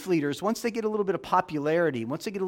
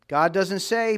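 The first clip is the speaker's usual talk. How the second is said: normal talking voice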